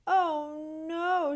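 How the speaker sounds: dismayed gasp. A narrator's reading. Porcupine finding her cranberry pail empty, dismayed gasp. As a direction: catch your breath at the empty pail. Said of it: "she gasped".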